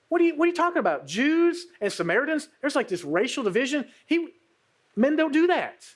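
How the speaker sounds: lightly voiced shocked woman; incredulous friend. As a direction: scoff in disbelief at the rumor. Lasagna's incredulous reply.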